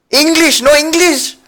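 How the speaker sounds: high, loud cry of protest; mock outcry. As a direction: call out in exaggerated dismay. Mock outcry at missing English text.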